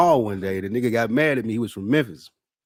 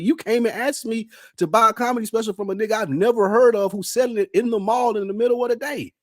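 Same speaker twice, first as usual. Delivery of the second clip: high-pitched incredulous rant, climbing higher at the end; indignant disbelief; 11 semitones higher than usual. Retold or imitated. Man retelling how he rebuffed a mall seller, indignant. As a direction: pile up the absurdities in a squeaky-high protest.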